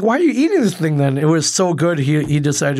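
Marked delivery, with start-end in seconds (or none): 0.0-1.2 high, loud disbelieving outburst; incredulous. Retold or imitated. To lactose-intolerant Rob eating cheese, high loud disbelief. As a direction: throw the question at him in disbelief.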